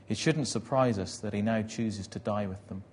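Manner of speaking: ordinary delivery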